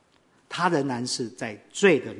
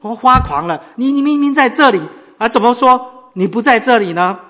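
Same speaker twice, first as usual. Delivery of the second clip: louder and higher, rising in alarm; the gambler panics, pushing faster and louder; anxious outburst; 7 semitones higher, 9 dB louder than usual. Retold or imitated. Gambler frets at Bai Kongba, agitated and loud. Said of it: "就着急了".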